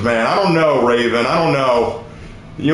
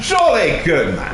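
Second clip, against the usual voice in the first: mock British accent. Showing off the British impression to chat, hammed-up British accent. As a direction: stretch it out like a cartoon Brit. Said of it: "Very good for a caricature".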